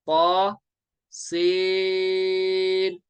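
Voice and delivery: "पा" one beat, "सीन" held very long; madd recitation. Shown as a demonstration. Teacher reciting Ta Seen for students, drawn out. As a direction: sustain the ee of "सीन" evenly. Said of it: "सीन को तीन अलिफ".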